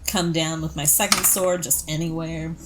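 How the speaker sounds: normal talking voice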